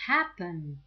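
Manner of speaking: Happen with a loud HAP, falling away; stress on first syllable. Shown as a demonstration. Teacher showing unstressed ending, Happen stressed on HAP. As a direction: punch the start of Happen. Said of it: "but the stress is not there".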